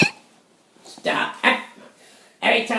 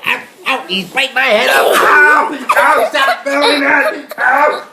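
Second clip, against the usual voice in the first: loud yelps of real pain; pained shouting; 2 semitones higher, 6 dB louder than usual. Real, not acted. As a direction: yell ow while laughing. Dog biting his head.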